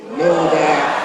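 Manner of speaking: loud, imitated West Indian professor; deep West Indian voice. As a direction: pronounce it weightily in a West Indian accent. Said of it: "in his deep West Indian voice".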